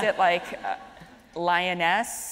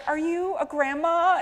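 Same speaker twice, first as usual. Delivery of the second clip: put-on higher, slower kid's question; cheeky kid; 10 semitones higher, 4 dB louder, slower than usual. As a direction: ask it like a cheeky child. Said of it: "someone was like".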